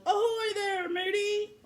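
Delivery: high, hammed-up pirate hail; pirate yell. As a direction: call out like a pirate captain. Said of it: "the head pirate climbed out of the boat and yelled".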